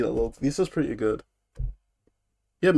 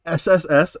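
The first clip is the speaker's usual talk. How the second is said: usual speaking voice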